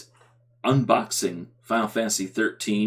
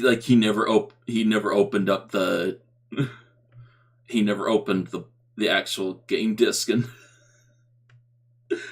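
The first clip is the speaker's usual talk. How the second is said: ordinary talk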